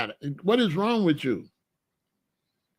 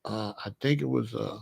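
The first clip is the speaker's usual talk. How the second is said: meek mumble; 5 semitones lower, 6 dB quieter than usual. Retold or imitated. Client answering the psychiatrist meekly.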